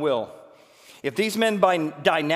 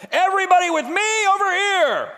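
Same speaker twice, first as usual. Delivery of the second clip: much higher, loud calling out; rallying call; 9 dB louder than usual. Acted. Korah rallying the whole congregation, calling loudly. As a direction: call the crowd over to your side.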